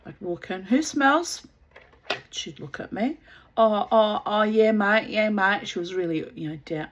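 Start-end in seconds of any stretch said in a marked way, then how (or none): 0.6-1.5 a raised voice calling out who smells; mock disgusted call. Walking in on a neighbour's unchanged nappies, a loud disgusted call. Walking into the dirty-nappy house. 3.6-5.7 imitating a broad Aussie neighbour brushing it off; ochre Aussie. Mimicking the slack neighbour in a broad Aussie accent. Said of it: "what we call ochre Aussie".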